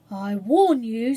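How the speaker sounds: solemnly, slowly; solemn warning. A narrator's reading. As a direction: deliver it like a grave prophecy. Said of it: "said Cyril solemnly".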